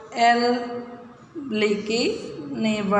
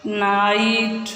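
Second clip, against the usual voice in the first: slow model word; much slower than usual. Shown as a demonstration. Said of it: "इकट्ठे बोल के देखो".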